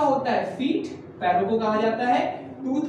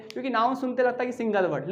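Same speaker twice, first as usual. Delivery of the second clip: usual speaking voice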